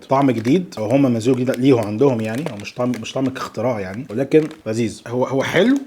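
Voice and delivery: normal talking voice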